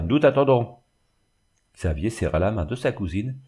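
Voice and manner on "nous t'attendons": higher, louder severe summons; stern. A narrator's reading. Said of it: "dit-elle d'une voix sévère". (rappel sévère, plus haut et plus fort)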